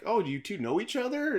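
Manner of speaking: high-pitched curious question; surprised wife. Retold or imitated. Wife asks husband and teacher about knowing each other, surprised. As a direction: pitch it up like a wife caught unawares. Voicing the unsuspecting wife's question.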